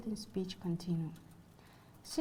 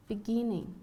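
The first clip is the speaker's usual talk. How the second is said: "beginning" with a louder middle syllable, GIN; stress on second syllable; 4 dB louder than usual. Shown as a demonstration. Lecturer gives an English example of free stress, second-syllable stress in "beginning". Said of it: "in another on the second syllable".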